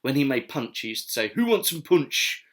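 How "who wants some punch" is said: higher, louder, slower mock Staffordshire accent; teasing Staffordshire accent, slow and raised. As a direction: imitate Johnson's Staffordshire accent on punch. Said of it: "he had a thick Staffordshire accent".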